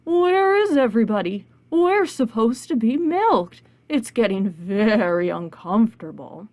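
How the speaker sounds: cow voice. Acted. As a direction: stretch the words like lowing cattle. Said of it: "all the cows said".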